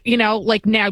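ordinary talk

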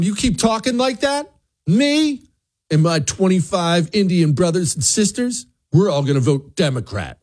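high whiny voice, mocking the threatener; mock whiny threat. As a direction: sneer it like a petty ultimatum. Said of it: "and I'll tell him".

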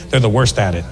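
usual speaking voice

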